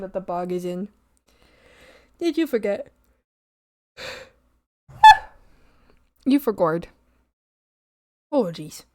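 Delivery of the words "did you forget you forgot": normal talking voice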